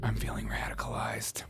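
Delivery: whispered, hushed comic confession; mock whisper. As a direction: whisper it as a playful aside. Mock-ominous aside to the audience.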